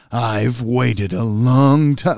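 put-on slow, loud, menacing odd accent; Robin's odd accent. Imitating Robin's weird accent over Zuko at the dock. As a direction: draw it out in a strange angry accent. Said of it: "he's talking differently".

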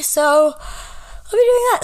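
real yawn stretching the words. Real, not acted. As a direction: say it mid-yawn, slowly. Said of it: "Sorry, yawn".

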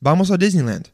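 "Disneyland" run into the Spanish phrase; easy Spanish-accented "vamos a Disneyland". Retold or imitated. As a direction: keep Spanish rhythm through "Disneyland". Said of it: "They just go".

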